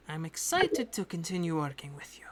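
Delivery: hushed confiding whisper to the party. Acted. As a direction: lean in and breathe it like a secret.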